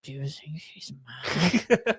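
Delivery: slow, soft, sheepish whisper. Retold or imitated. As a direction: lean in and whisper it guiltily. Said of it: "he whispers in my ear".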